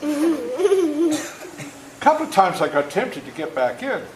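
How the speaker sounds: words stretched out through real laughter, slow and high; can barely get it out for laughing; laughing while telling. Real, not acted. Storyteller laughing at his own childhood mishap with the wagon, laughing through the words.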